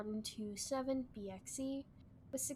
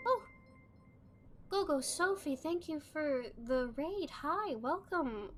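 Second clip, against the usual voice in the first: high, bright excited greeting; cute anime streamer; 5 semitones higher, 5 dB louder, slower than usual. Real, not acted. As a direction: squeal a thank-you to the raider.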